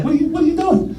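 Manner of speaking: high disbelieving mock outburst; incredulous. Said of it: "I'm like".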